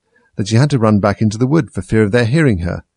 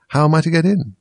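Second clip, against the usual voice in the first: Alice repeating; 7 semitones higher than usual. A narrator's reading. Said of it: "she repeated aloud".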